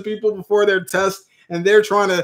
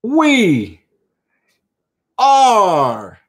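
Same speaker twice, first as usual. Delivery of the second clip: excited play-by-play; 7 semitones higher, slower than usual. Acted. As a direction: call it like a live sports moment. Announcing winning bid.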